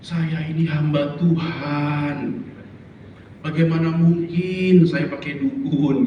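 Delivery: slow, drawn-out protest; acted slow, steadfast reply; devout woman's refusal. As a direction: stretch each phrase out as a pious refusal. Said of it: "dia selalu bilang begini".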